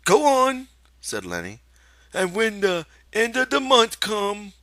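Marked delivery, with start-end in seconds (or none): 0.1-0.7 put-on high, childlike confession; Lenny confessing. Lenny urging. 2.1-4.5 put-on high, loud eager childlike voice; feed him the line eagerly; Lenny prompting.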